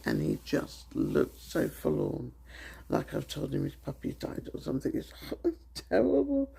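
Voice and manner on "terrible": breaking into a real laugh on it; amused laugh through the word. Real, not acted. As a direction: laugh the word out, high and loud. Laughing at husband's sad face.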